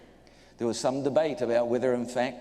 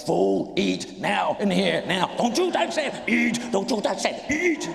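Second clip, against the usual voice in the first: much higher and louder, two inner voices fighting, laughing through it; stomach versus brain; 5 semitones higher, 4 dB louder than usual. Retold or imitated. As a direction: squeal the stomach's demands against the brain's warnings. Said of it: "stomach's going".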